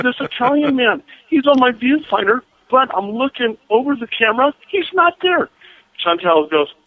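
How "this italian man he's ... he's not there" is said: high-pitched retold astonishment; alarmed disbelief. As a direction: report the impossible, pitch climbing high.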